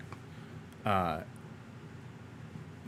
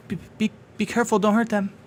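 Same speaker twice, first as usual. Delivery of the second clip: high, fast stammering kobold; rushed, pitched-up worried Meepo; anxious kobold plea; 10 semitones higher, much faster than usual. Acted. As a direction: blurt it out, scared for friends. Meepo pleads at the door.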